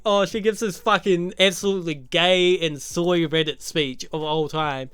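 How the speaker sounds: ordinary talk, nothing marked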